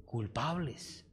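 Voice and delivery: drawn out slowly and softly; slow, soft answer. Shown as a demonstration. Pastor fills in the word for the crowd, slow and soft. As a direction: let the word fall slowly and quietly. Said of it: "No busquemos, ¿qué?".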